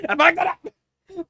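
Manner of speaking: frustrated shout. Real, not acted. The reader annoyed at a flubbed line, shouting "I fucked that up!". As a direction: yell it at yourself after the flub.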